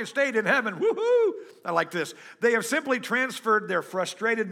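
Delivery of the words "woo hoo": joyful whoop. Real, not acted. Preacher cheers, delighted by the line.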